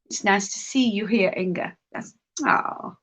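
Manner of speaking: usual speaking voice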